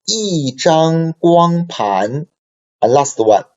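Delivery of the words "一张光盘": "一张光盘" drawn out, higher pitch, 一 falling; very slow tone demo. Shown as a demonstration. Teacher models the example very slowly for the class. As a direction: stretch every syllable of "一张光盘". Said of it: "also first tone".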